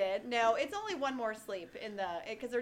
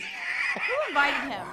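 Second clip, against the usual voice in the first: mock outraged shout; 2 semitones higher, 7 dB louder, much slower than usual. Mock outrage at co-host's pick.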